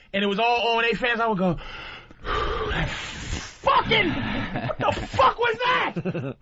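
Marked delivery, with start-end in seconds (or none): 2.7-6.4 heckler's yell. Retold or imitated. Retold heckler's angry outburst.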